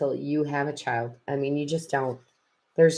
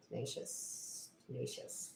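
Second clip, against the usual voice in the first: slow, barely audible whisper; 5 semitones lower, 23 dB quieter than usual. Real, not acted. Reader repeating a word to herself, whispered. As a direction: whisper the word to yourself, drawn out.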